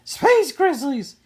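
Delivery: high, loud, slow frightened wail. A narrator's reading. Sister telling brother what chased her, frightened wail. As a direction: wail it out in terror. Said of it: "she wailed".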